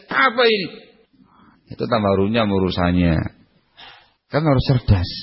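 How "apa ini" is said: higher, demanding snap; mock angry husband. Preacher acting a husband scolding his wife, mock angry demand. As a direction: play the irritated husband.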